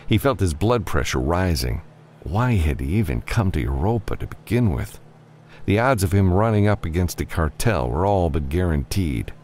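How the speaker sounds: ordinary delivery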